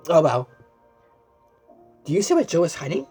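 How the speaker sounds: plain reading voice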